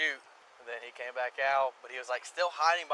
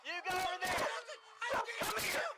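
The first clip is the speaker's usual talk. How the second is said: urgent high calling; 5 dB quieter than usual. Real, not acted. Ordering children with rising panic.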